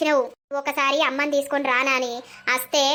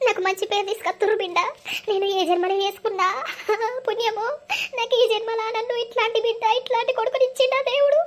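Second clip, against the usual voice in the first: tearful, rising higher to a sob; grateful weeping that climbs higher; crying; 6 semitones higher than usual. Acted. Mother thanking God for her son through tears. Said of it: "అమ్మా, నువ్వు ఏడవకే".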